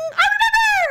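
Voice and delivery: very high, squealed eureka; sudden recall. Acted. Friend acting out remembering an old name, excited. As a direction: act out a name popping into your head.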